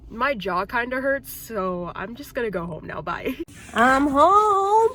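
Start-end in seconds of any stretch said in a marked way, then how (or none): 3.7-4.9 calling out. Real, not acted. Calling out arriving home.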